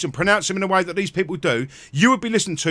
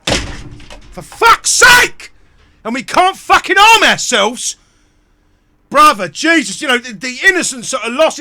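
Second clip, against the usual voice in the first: much higher and louder, furious shout; real enraged scream at the news; screaming in rage; 8 semitones higher, 7 dB louder than usual. Real, not acted. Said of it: "I'm shouting and screaming".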